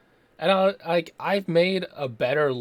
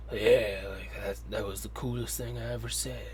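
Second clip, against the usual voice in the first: a hint of self-satisfied Seagal, dropping much softer; lightly voiced tough guy admiring his own line; smug Seagal; 3 semitones lower, 4 dB quieter than usual. Reviewer mocking Seagal's deep lines, mildly smug and hushed. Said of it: "he thinks he says something, like, really deep".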